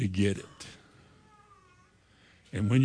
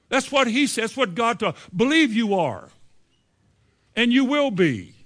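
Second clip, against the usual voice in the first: insistent retort; 6 semitones higher than usual. Acted. Imagined retort to doubters.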